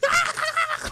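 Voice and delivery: very high-pitched cackling laugh; hyena cackle. Retold or imitated. Storyteller reenacting his messed-up laugh, hyena cackle. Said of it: "like fucking hyena laughing".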